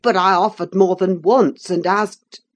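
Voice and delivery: low male voice, getting heated; kindling up. A narrator's reading. Cousin kindles up at the woman's pertness, heated and low. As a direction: protest back at the accusation. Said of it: "kindling up at her pertness".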